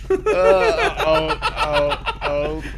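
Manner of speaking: high laughing "uh" sliding down into ohs; helpless groans through laughter. Real, not acted. Player being spun around by a friend, laughing groans. Flung about while spinning, laughing.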